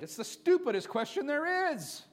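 loud, high impassioned preaching; full-force preaching. Real, not acted. Pastor urging the church at full preaching force. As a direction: preach it out to the whole church, fervent.

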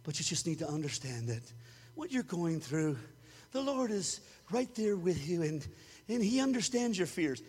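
low, soft mock counselor voice; a hammed-up soothing counselor. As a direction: imitate a gentle therapist.

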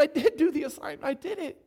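put-on high-pitched, insistent child's protest; passionate protest. Little boy insists to classmates he did it, passionate. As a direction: plead your case with passion. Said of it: "so passionate".

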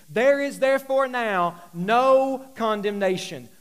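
loud, driving sermon build; impassioned pulpit delivery; full-force preaching. Acted. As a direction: preach it hard to the room.

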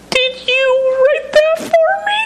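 very high, slow tearful wail; hammed-up high-pitched crying voice; mock bawling. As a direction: imitate someone bawling with overwhelmed emotion.

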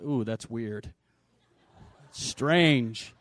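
mock a judgmental church member; judgy churchgoer. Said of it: "And you're like".

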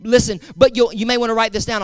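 ordinary talk

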